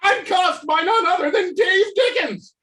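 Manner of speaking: manic ranting villain. Acted. Unmasked Ted raves at Dave Dickens, hysterical laughing rant. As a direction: rave in giddy hysterical relief.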